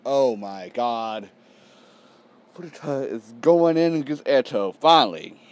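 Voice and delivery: loud, excited exclaiming; thrilled reaction. Real, not acted. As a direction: cry out at a long-awaited showdown. Thrilled reaction to awaited fight.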